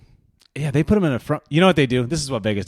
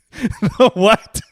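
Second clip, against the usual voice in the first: louder, high-pitched query through laughter; incredulous laughing; 8 semitones higher, 6 dB louder than usual. Real, not acted. Co-host laughs at a garbled island name, incredulous. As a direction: crack up while asking it.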